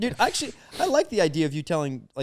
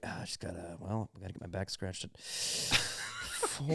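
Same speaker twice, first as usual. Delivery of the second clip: much softer, slow, put-on small kid voice; baby's voice; 9 semitones lower, 14 dB quieter, slower than usual. Friend pitching the joke, slow soft voice of a busy toddler. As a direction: murmur it slowly like a fussy toddler complaining. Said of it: "we'd be like, as a kid".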